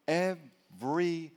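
"Everything" slowly split into Ev-ry-thing; three-syllable pronunciation. Shown as a demonstration. Preacher stressing answers for everything, "Everything" in three slow syllables. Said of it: "Three syllables".

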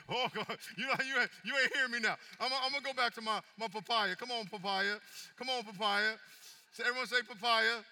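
loud, high, fired-up preaching; full-force preaching. Real, not acted. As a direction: whip the church into a joyful frenzy.

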